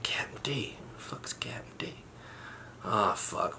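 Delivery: hushed, breathy confusion under his breath; a puzzled whisper, barely voiced; whispered to himself. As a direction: whisper it like nobody should hear.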